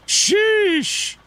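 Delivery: high, drawn-out exclamation; exclaiming. Real, not acted. Chatting streamer reacts to one country destroyed, exclaiming. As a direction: let it soar way up in disbelief.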